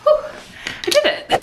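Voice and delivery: high, laughing relief; elated little victory cry; real triumph. Real, not acted. Relief after finishing balance exercise.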